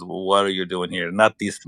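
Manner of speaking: usual speaking voice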